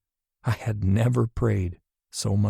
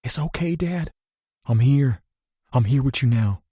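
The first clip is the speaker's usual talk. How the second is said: reassuring; 5 semitones higher than usual. Acted. Comforting waking father.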